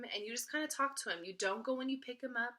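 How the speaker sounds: ordinary talk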